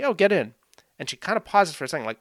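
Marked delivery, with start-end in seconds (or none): none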